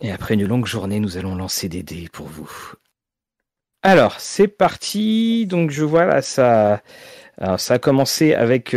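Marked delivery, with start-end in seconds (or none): 0.0-2.5 soft, hushed ASMR greeting; breathy, intimate whisper into the mic. Pretend. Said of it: "t'imagines, de l'A S M R de dés".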